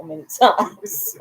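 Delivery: words breaking into laughter; laughing. Real, not acted. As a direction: crack up at your own joke. Jokes about her husband, laughs.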